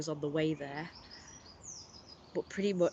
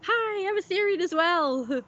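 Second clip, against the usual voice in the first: high, loud, delighted hello; real high-pitched friendly call; excited greeting; 11 semitones higher, 5 dB louder than usual. Real, not acted. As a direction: call out eagerly to a newfound fellow. Calling out greeting to someone else.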